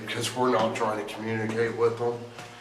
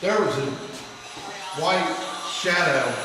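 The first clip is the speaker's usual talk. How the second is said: higher-pitched, astonished report; startled report; 7 semitones higher than usual. Real, not acted. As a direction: tell them what just startled you.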